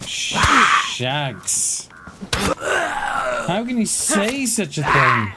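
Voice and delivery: high, slow, wailing cry of indignation; hammed-up shocked wail, as if deeply wounded; mock outrage. Teasing teammate Shags for his accusation, hammed-up wailing outrage.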